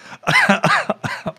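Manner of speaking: sudden sputtering cough; choking cough. Real, not acted. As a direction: cough hard as if swallowed wrong. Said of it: "hab ich mich verschluckt".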